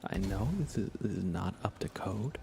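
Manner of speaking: ordinary talk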